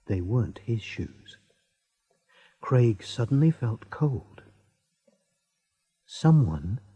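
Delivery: ordinary delivery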